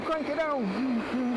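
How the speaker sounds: crank it on stretched very slow; drawn out. Real, not acted. Cook calling to his wife, stretched slow call.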